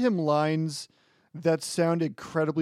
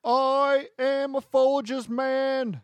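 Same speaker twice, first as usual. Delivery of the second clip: ad spokesman; 8 semitones higher, much slower than usual. Pretend. Mock ad spokesman line.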